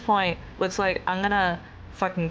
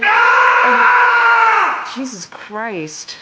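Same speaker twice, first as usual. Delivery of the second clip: loud, slow, higher blurt of disbelief; exasperated outburst; 12 semitones higher, 10 dB louder, much slower than usual. Real, not acted. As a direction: let "Jesus Christ" burst out at full volume.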